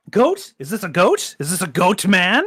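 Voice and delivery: gasp, then rising excited questions; thrilled to spot a goat man. Real, not acted. Player gasps at goat statue.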